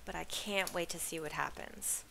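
ordinary delivery, nothing marked

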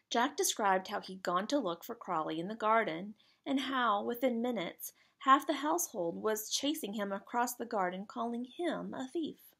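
usual speaking voice

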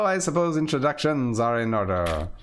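ordinary talk

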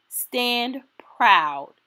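ordinary delivery

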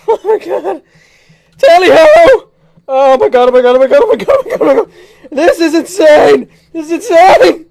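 loud, high, breathless screams of thrill; ecstatic screaming. Real, not acted. Player screaming with joy on the drop, thrilled. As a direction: scream with joy as you plunge.

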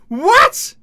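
high, loud astonished what; loud high disbelieving what; surprised what. Real, not acted. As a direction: blurt out in shock. Learns player runs business.

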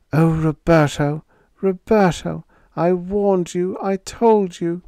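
high, lamenting wail of a mother; soft wail. A narrator's reading. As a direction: wail it, grieving and reproachful. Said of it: "Missus Sanchez softly wailed".

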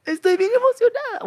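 very high, put-on excited voice; mock excitement. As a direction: squeal it as an imitated gush. Acts out a gushing quote.

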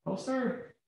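high, small and very polite; meek high reply. Retold or imitated. To the cop at his window, a high meek reply. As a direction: shrink and answer the armed officer meekly.

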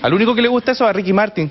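ordinary talk, nothing marked

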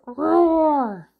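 loud playful roar; dinosaur roar. Shown as a demonstration. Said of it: "Dinosaur sound".

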